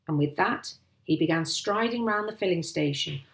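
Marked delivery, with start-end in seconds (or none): none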